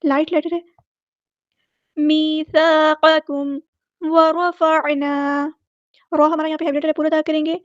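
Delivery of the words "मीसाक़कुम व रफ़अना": drawn-out, measured recitation of each word; slow Quranic recitation. Shown as a demonstration. Teacher reciting verse words slowly for students. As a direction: chant each syllable slowly like a tajweed teacher.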